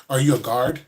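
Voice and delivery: normal talking voice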